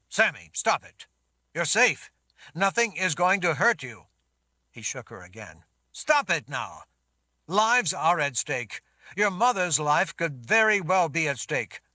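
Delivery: loud, stern and insistent; commanding Richard. Acted. Richard shakes sobbing Sammy.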